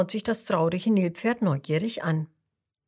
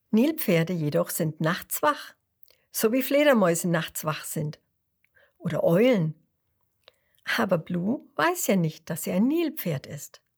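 ordinary delivery

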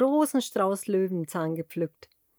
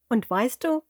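usual speaking voice

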